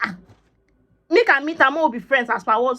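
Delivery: high, loud burst of objection; exasperated retort; indignant protest. Retold or imitated. Retelling her protest to friend.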